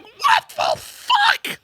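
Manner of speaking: very high, drawn-out mock outrage; retold incredulous outburst. Dad learning it's already paid for, mock incredulous wail. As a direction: stretch it into a high imitation of dad's meltdown.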